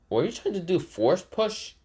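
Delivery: high, scornful mock question; lightly voiced mocking hero. Naruto mocking the weak push.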